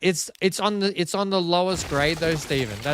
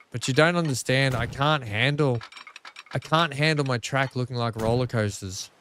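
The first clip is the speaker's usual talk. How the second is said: usual speaking voice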